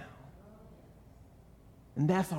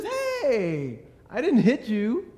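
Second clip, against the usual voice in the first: higher, whining cowardly lion voice; whiny lion; 7 semitones higher than usual. Retold or imitated. Pastor voicing the Cowardly Lion whining at Dorothy. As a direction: protest like a cowardly bully caught out. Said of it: "he says".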